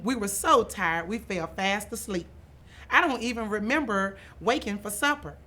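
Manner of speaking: ordinary delivery, nothing marked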